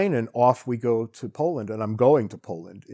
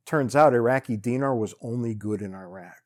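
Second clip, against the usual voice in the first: ordinary talk, nothing marked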